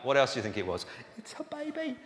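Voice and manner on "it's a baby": put-on very high, soft, airy cooing of It's a baby. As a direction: coo It's a baby gently, as if to the infant itself. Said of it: "you find yourself saying the dumbest things".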